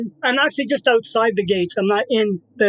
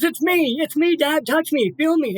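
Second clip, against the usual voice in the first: gentle dog voice; 7 semitones higher than usual. Retold or imitated. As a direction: say it high and gentle, reassuring. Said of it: "he goes".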